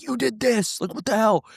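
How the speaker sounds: high, yelled mock outburst; retold scream. Head coach yelling at the ref, high mock yell. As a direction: shriek it like an angry coach. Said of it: "And he was screaming".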